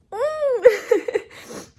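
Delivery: high, loud sob-laugh; tearful laugh. Real, not acted. Wishing her husband were there, high tearful sob-laugh. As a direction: let a teary laugh break through. Crying over absent husband.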